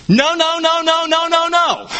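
high rapid-fire string of "no"; emphatic negation. Shown as a demonstration. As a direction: fire off "no, no, no" on one high, insistent note. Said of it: "With big exclamation points in bold block letters".

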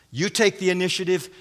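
brisk instruction, voice held up; addressing the first. Acted. Preacher voicing Paul to the first woman, brisk instruction. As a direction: hand it to one party at normal volume. Addressing each of two women in turn.

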